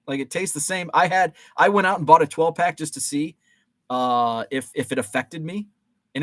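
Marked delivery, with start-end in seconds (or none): none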